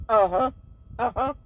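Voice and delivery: repeated short uh-huh, each rising; knowing uh-huh. Retold or imitated. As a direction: imitate doubtful, noncommittal listening. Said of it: "She had this, "Uh-huh," sound".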